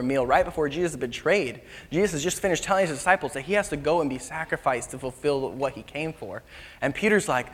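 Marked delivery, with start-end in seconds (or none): none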